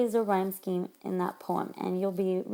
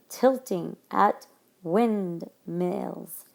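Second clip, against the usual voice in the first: "tilting at windmills" very slow, each short I picked out; "tilting at windmills" broken up slowly, leaning on each short I; stressed I sound; 3 semitones lower, much slower than usual. Shown as a demonstration. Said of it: "we see the I".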